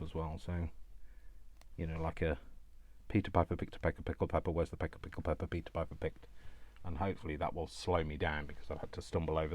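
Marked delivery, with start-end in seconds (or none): 3.1-6.3 rattled off fast and evenly; tongue twister. Shown as a demonstration. Crafter demonstrates a speech exercise, fast tongue twister. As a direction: race through it like a well-drilled tongue twister. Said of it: "throw in a tongue twister".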